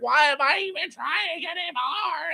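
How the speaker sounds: high, whiny mock wail; hammed-up cry of giving up; mock despair. Voicing Mickey as brooms overpopulate, mock despair.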